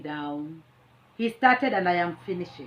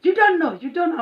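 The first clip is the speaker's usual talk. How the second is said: much higher and louder mock yell; mock driver shout; 9 semitones higher, 8 dB louder than usual. Quoting the angry driver, high mock yell. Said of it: "Then it's like".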